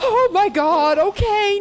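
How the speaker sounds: high, loud shriek of fright; frightened scream. Real, not acted. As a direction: scream at the sudden jump scare. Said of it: "I'm sorry for screaming".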